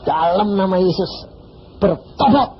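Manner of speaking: preacher imitating a rebuking prayer; commanding prayer. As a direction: command him to repent.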